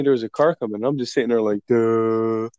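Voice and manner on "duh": drawn-out, dazed "duh"; dumbfounded. Retold or imitated. Stoned teller acting himself at the intersection, dumbfounded. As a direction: imitate your own blank, dopey stupor. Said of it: "dumbfounded and shit".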